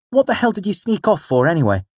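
high, loud, angry question; Bast, demanding. A narrator's reading. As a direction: demand an answer, starting high and dropping at the end. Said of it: "Bast demanded".

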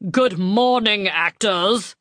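lightly voiced grand stage actor; slow, grand theatrical greeting; booming Ian McKellen. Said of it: "booming out in his perfect voice".

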